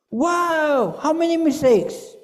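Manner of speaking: hammed-up delight at the grade; mock amazement. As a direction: grandpa cheering, high and loud. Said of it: "Sabi ko".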